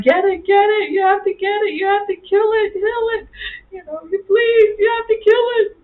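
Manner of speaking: high, half-crying frantic pleading; screaming and crying. Retold or imitated. Wife begging husband to kill the spider, high tearful pleading. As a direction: beg him frantically to kill it. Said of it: "I'm screaming and crying".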